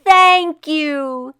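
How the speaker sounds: high, loud delighted cry; boy thanking the crowd, high and loud; joyful child. Acted. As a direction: thank everyone gleefully. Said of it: "he said".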